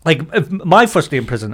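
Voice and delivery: usual speaking voice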